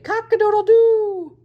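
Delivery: high, loud crowing line; rooster crow. A narrator's reading. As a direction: belt it like a proud rooster. Bob crows at the fox.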